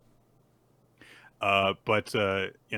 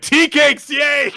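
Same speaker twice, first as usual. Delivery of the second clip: very high, loud mock cheer; excited; 16 dB louder, slower than usual. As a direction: shout it like a child getting sweets. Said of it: "Just excited for the sweets".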